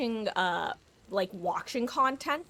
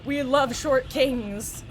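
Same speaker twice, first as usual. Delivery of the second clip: slow drawl; 4 semitones higher, 6 dB louder, much slower than usual. Pretend. Drawled compliment to killer.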